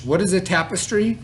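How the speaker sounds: ordinary talk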